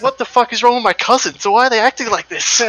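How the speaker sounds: high-pitched mock exasperation; bewildered Aussie mate. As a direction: imitate a baffled mate complaining about his cousins. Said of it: "He just tells it in the best way".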